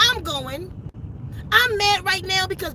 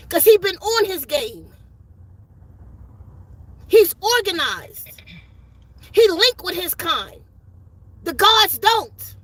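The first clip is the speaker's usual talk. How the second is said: fiery preaching. Real, not acted. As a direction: preach it at them, pounding each question. Preaching at full force.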